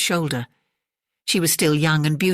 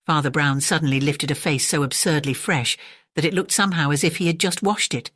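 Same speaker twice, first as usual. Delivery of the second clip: ordinary delivery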